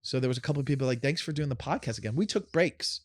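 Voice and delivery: fast, wired from coffee; too much caffeine. Real, not acted. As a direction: rush it like you're overcaffeinated. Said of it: "The coffee's kicking in and I'm going fast".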